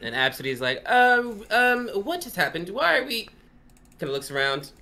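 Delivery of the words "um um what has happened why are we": confused noble. Acted. Absidy confused.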